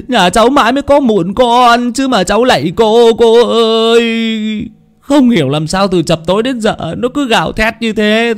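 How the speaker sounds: high pleading wail; sobbing plea for the child's life; begging mother. Acted. Desperate mother begs the medium to save her son, high and pleading.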